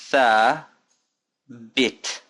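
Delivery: THA held long, pause, then BIT; syllable by syllable. Shown as a demonstration. Teacher asking learners to pronounce along, slow split syllables. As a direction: break it up for repeating, THA ... BIT. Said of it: "Pronounce it with me now".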